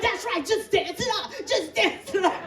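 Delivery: high, chanting encouragement; peppy mock cheerleading; hyping up child. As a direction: egg him on in a high voice. Hyping up fallen son.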